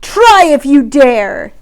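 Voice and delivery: slow, loud dare; slow booming dare; billowing challenge. Acted. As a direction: boom back a challenge. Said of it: "the biggest goat billowed right back".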